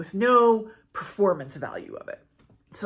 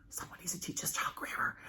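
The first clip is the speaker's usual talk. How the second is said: breathy whispered jab about grammar; whispered joke; 13 dB quieter than usual. Real, not acted. Whispering a teasing joke to viewers about Huck's grammar. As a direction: whisper it as a cheeky aside.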